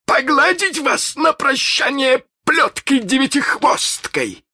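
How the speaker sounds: high, loud snarling pirate captain; pirate captain's threat. Acted. Hook threatens the captured boys, loud and high. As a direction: bellow the threat like a cruel captain.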